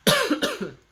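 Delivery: a real, rough chesty cough. Real, not acted. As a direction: cough hard from a sore throat. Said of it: "Sorry, and I'm sorry I'm coughing".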